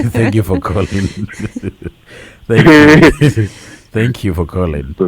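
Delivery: laughing through the thanks. Real, not acted. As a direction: thank him while still chuckling. Host laughing at caller's joke.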